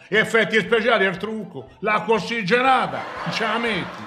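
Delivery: high, loud exasperated rant about the makeup; excited outburst. Acted. Producer ranting at his partner about special effects, loud and high. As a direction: shout it out, carried away with the idea.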